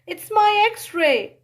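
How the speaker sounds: high, loud and slow; excited girl, slow. A narrator's reading. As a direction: show it off slowly with delight. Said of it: "she said".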